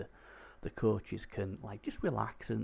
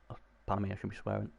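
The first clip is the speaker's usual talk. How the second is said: usual speaking voice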